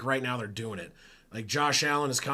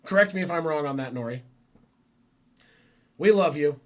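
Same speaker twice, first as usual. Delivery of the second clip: usual speaking voice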